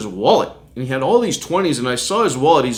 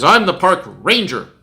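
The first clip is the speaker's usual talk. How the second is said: higher, louder claim of authority; acted self-important announcement; 6 semitones higher, 5 dB louder than usual. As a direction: imitate a man pulling rank. Angry man claims authority, acted.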